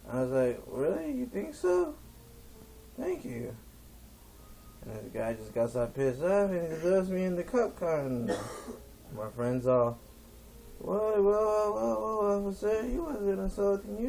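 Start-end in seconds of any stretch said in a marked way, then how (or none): 0.7-3.5 high, mock-delighted comeback; sarcastic gratitude. Sarcastic comeback to insult, acted. 10.8-14.1 high-pitched imitation of a protesting friend; urgent protest. Friend protesting the arrest, acted.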